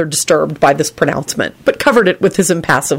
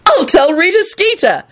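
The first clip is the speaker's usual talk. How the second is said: high, crowing threat; gleeful; 13 semitones higher than usual. A narrator's reading. George threatening to spread gossip, gleeful. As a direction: crow it pitched way up.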